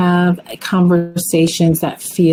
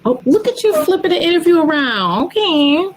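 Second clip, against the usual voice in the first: quick, very high-pitched teasing; playful surprise; 12 semitones higher, faster than usual. Real, not acted. Host teasing the guest who turns the questions round, playfully surprised. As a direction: react in a squeaky-high, amused voice. Teases guest for reversing the interview.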